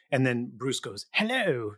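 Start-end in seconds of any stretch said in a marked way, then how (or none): 1.1-1.7 put-on a quick hello in a higher English accent. Bruce greeting Jerry while faking an English accent. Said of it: "like, in his English accent".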